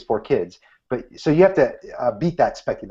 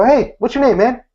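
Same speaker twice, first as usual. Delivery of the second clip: friendly greeting; 10 semitones higher, 4 dB louder than usual. Shown as a demonstration. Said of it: "you look 'em right in the eyes and go".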